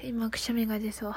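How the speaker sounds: whispering, holding back a sneeze; hushed, sneeze coming on; about to sneeze. Real, not acted.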